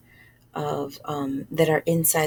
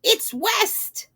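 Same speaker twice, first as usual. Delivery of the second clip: very high squawking crow voices; cawing crows; 13 semitones higher, 3 dB louder, slower than usual. Acted. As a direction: call it out like four noisy crows. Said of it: "they cried".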